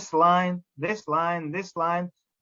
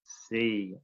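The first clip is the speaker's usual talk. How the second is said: lower, softer echo of C; students repeating; 5 semitones lower, 7 dB quieter than usual. Retold or imitated. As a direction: echo C back like a class. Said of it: "And the students would repeat".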